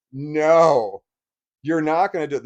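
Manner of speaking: a judge shutting her down for good; stern refusal. Retold or imitated. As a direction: lay down the law like the judge. Judge overruling the ex-wife in court.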